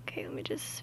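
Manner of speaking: soft whispered aside; whispering. Real, not acted. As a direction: whisper it to yourself. Quick aside while working.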